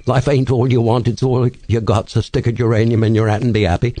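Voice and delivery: chirpy London Cockney patter; Cockney accent. Shown as a demonstration. Host demonstrating British accents to interviewer, Cockney. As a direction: play a Cockney man dishing out homely advice. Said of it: "And then there's a Cockney man".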